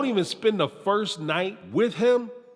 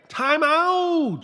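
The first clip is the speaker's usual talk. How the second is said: time out; 10 semitones higher than usual. Acted. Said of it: "calling a stop".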